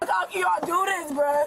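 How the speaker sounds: ordinary talk, nothing marked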